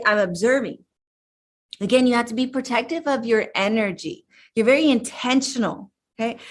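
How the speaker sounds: usual speaking voice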